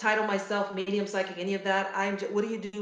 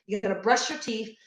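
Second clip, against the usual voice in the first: ordinary delivery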